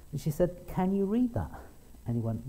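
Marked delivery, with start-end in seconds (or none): 0.7-1.5 put-on little girl. Little girl voiced in story.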